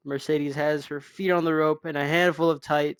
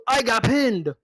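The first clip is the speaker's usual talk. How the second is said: louder and higher, exasperated; frustrated outburst; 8 semitones higher, 8 dB louder, slower than usual. Acted. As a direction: blurt it out like a sore loser. Rock fumes in locker room.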